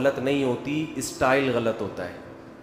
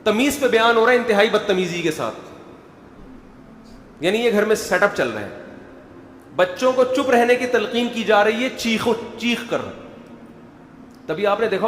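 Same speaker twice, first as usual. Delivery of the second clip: ordinary delivery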